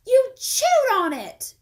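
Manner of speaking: very high, loud, slow outrage; girl shocked by chewed pencil; disgusted exclamation. Acted. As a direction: shriek it in shock. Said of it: "exclaimed Rondi".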